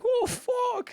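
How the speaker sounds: high, loud mock wail; mock crying. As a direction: wail it like a sudden sob. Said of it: "starts, like, crying".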